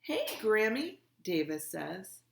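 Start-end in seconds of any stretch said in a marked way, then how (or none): 0.0-1.0 high, loud hello into the phone; calling greeting. A narrator's reading. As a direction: greet grandma loudly, pitched up. Kid greets grandmother on speakerphone.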